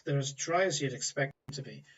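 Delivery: bored, sighing complaint; acted teenage boredom; bored young woman. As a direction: grumble through a sigh, unimpressed by everyone. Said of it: "she sat there completely bored".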